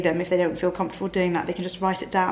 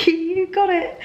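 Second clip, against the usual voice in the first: playful high; 10 semitones higher than usual. Real, not acted. Playful aside.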